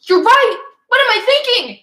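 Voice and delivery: loud, high, self-scolding king; greedy king. Retold or imitated. The king realising he asked too little, loud and high. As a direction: slap your forehead as the king, high and loud.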